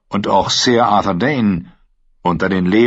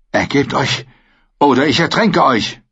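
threatening shout; 6 semitones higher than usual. Acted. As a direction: threaten him, building up. Brienne droht ihn zu ertränken. (drohend, lauter werdend)

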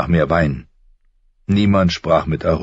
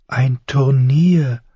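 breathy and slow, pitched higher in wonder; breathed; 9 semitones higher, much slower than usual. A narrator's reading. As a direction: breathe it out, dazzled. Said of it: "hauchte sie".

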